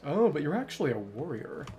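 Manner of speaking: ordinary delivery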